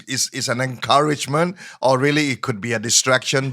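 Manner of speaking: ordinary talk, nothing marked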